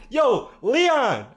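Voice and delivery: high, loud call across the store. Retold or imitated. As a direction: call a coworker over, loudly. Calling coworker during robbery.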